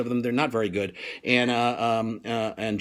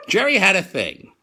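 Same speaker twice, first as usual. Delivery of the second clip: ordinary delivery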